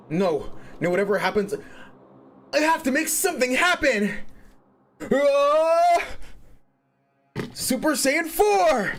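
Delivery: high, loud anime hero battle cry; shonen protagonist voice. Pretend. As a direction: scream it like a powering-up anime hero. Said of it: "My shonen protagonist voice is acting up again".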